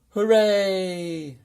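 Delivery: high, loud cry of joy; a boy cheering on finding the Yeti; joyful shout. A narrator's reading. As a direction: shout it out with delight. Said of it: "shouted George".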